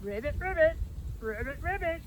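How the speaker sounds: put-on playful croaking ribbits for the kids; a light, playful toad voice. Toad in picture-book read-aloud.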